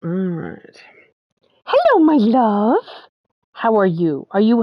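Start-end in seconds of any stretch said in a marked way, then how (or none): 1.6-2.8 pet talk. Real, not acted. Greeting the pet cat.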